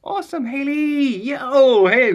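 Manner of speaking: high, drawn-out whoop, easing down; excited cheer. Real, not acted. Streamer hails Haley for recording, excited. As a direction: cheer loud and high, then settle.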